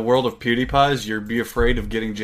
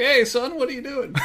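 much higher folksy dad voice; Charlie's dad impression; 12 semitones higher than usual. Dad checking on his son, much higher. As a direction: pitch it up like a chummy dad. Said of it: "I do a spot-on impression of your dad".

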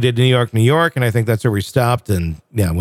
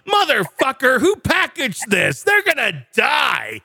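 higher, slow mock rage; furious shout; 11 semitones higher, slower than usual. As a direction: rage at whoever packaged it. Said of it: "not quite like that".

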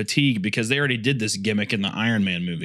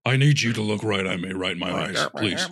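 deep intense robot voice; 4 semitones lower than usual. Retold or imitated. Acting Optimus scene.

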